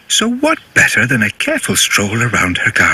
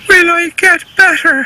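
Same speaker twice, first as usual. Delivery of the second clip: worried pony; 5 dB louder than usual. Acted. Hurt pony asks cat.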